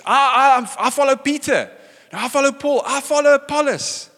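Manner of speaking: loud, high-pitched partisan boasting; rival followers clamouring. Acted. Preacher mimics squabbling church factions, loud rival clamour. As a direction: call out your allegiance over the others.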